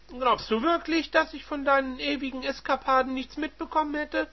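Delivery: high, loud accusing voice; reproachful wife. Acted. Wife accusing her cheating husband, shrill and loud. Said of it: "warf Evelyn Dax ihrem Mann vor".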